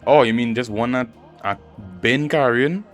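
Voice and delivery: normal talking voice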